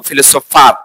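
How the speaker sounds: "ফিলোসোফার" the American way, final r sounded; American ফিলোসোফার, ending in r; American pronunciation. Shown as a demonstration. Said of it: "যদি আমরা American উচ্চারণের". (American উচ্চারণে "ফিলোসোফার")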